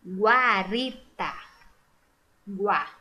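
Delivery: "Guarita" slow by syllables, then "Guá" alone; "Guarita", "Guá", U clearly voiced; U sounded out. Shown as a demonstration. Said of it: "Pronunciei sim".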